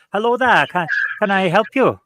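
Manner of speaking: cheery Welsh barman voice; Welsh accent. Shown as a demonstration. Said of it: "a Welsh accent for this one".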